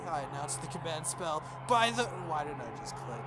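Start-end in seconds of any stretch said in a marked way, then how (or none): none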